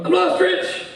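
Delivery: high, plaintive monotone; drunk Rob. Retold or imitated. Lost drunk friend phones Rich for help, high and plaintive. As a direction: whine it like a helpless, overserved friend. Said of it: "phone call from Rob".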